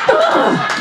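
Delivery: very high frustrated "ugh"; high-pitched comic groan; exasperated groan. Acted. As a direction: let out a squeal of exasperation. Frustrated noise at neighbours.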